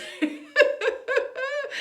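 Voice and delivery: real delighted laughter; loud high laugh. Real, not acted. Mother laughing at her daughter's classmate story, high and loud.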